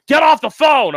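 sudden loud, high-pitched yell; yelling cop. Retold or imitated. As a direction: yell an order like an angry cop. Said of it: "a cop yelled at me".

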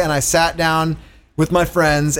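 ordinary delivery

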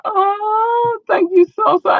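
high, crying thank you; hammed-up tearful gratitude, voice breaking; mock sob. Host thanking loyal listeners, mock crying high.

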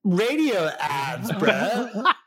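high, slow, know-it-all bro voice; mock patronizing industry guy; imitating a condescending bro. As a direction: correct them like they're clueless. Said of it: "Or they're like".

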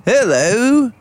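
Hawaiian pidgin. Acted. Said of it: "Hawaiian accent to him".

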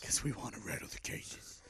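low gravelly Batman growl. Retold or imitated. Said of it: "you by far have the better Batman impersonation".

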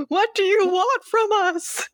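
high hammed-up yell, arms out wide; a horror victim hollering into the void, hammed up; mock yell at the camera. Mocking the trapped guy yelling at the camera. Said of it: "Mitch yells at the camera".

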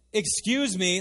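pointed, offended interjection; indignant woman. Acted. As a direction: push back, refusing to be meek. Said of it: "Instead, she goes".

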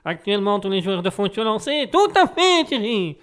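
high, loud sports commentator voice; football commentator. Pretend. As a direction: call it like live football commentary. Mimics football commentator catchphrase.

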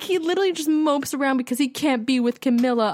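ordinary talk, nothing marked

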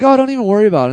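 high, loud, breezy reassurance; hammed-up cheerful dismissal; mock gushing producers. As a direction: play the producers brushing it off with gusto. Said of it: "they were like".

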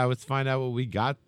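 ordinary talk

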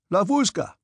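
high, irritated call to a servant; angry shout. A narrator's reading. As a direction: shout for the orderly angrily. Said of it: "he shouted loudly and angrily".

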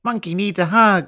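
put-on high, childlike cuddly toy voice; cute monkey voice. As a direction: beg for a hug like a toy monkey. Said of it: "a little bear impression".

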